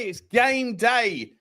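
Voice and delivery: slow, stretched "It's game day" with weight on "game"; drawn-out repeat. Real, not acted. Host repeating the hype to viewers, slowly drawn out.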